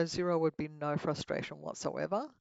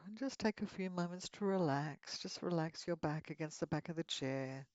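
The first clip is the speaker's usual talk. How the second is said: guided meditation; 6 dB quieter than usual. Acted. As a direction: lead them gently into relaxation.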